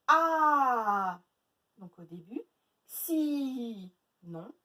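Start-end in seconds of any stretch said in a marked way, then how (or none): 0.1-1.2 A loud and held long; stretched vowel. Shown as a demonstration. Teacher letting pupils hear the A, stretched vowel. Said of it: "Où est-ce qu'on entend le A?". 2.9-3.9 SI with a long held i; stretched syllable. Shown as a demonstration. Teacher checking the middle syllable for A, stretched. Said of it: "Où est-ce qu'on entend le A?".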